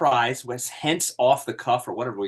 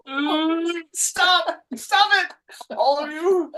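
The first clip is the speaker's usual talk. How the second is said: much higher, louder shrieked plea to stop; shrieking stop; 3 dB louder, much slower than usual. Real, not acted. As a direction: squeal at everyone to quit it. Begs others to stop accents.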